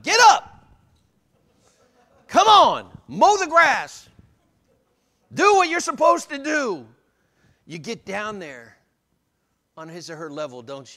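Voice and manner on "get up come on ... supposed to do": high-pitched, very loud barked orders; mock yelling. Preacher mimicking a parent yelling at a crawling baby, shouted high. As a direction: yell it like an impatient parent at a toddler. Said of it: "stand there and yell at them".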